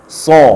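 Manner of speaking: "So" with a heavy, rounded Arabic sad; thick sad. Shown as a demonstration. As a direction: raise the back of the tongue on "So". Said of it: "itu dia shad".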